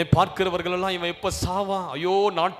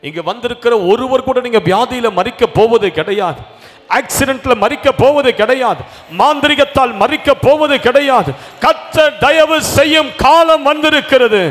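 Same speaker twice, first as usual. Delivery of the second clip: loud, higher, driving preacher's cry; preaching at full force; 7 semitones higher, 12 dB louder than usual. Acted. Preacher proclaims to the congregation, loud and fervent. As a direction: hammer each promise home to the crowd.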